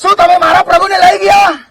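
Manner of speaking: high, anguished cry to the angels; Mary begs the angels for her Lord, distraught; Mary pleading. Acted. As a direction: beg them to show where he is. Preacher voices Mary pleading with angels.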